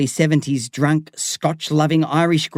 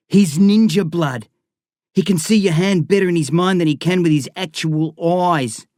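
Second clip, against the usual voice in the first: Eric voice; 5 semitones higher, 3 dB louder than usual. A narrator's reading. Eric's different voice.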